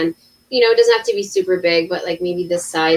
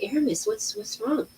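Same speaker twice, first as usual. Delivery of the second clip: put-on quiet, hushed concern for a scared child; soft worried mother; 3 semitones higher, 9 dB quieter than usual. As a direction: ask gently and quietly, just woken. Mother woken by crying daughter at dawn.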